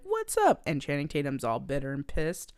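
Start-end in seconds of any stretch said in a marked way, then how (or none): 0.0-0.6 high mock greeting. Viola greeting roommate.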